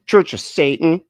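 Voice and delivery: mock a scandalised church lady. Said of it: "Okay, church lady".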